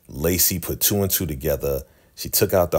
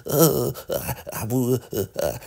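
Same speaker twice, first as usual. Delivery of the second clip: high, faltering mock stammer; imitating a scared halting voice; 5 semitones higher than usual. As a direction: stumble over the words like a frightened girl. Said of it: "Because Bridget is scared".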